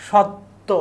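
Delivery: সত্য with a held double t and silent b; silent ব-ফলা. Shown as a demonstration. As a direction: say সত্য as "shotto", no b sound. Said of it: "বয়ের উচ্চারণ পাচ্ছি না".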